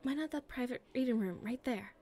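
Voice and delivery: usual speaking voice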